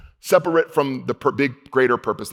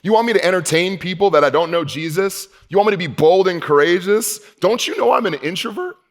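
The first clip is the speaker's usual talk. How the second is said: mock protest to God; 6 semitones higher than usual. Protesting to God about being introvert.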